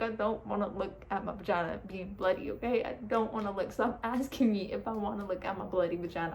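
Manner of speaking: normal talking voice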